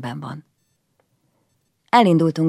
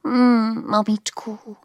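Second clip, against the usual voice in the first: lightly voiced little boy, murmured; a hint of a child murmuring; small boy's murmur; 8 semitones higher, slower than usual. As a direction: mumble it to yourself like a child. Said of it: "suttogta maga elé".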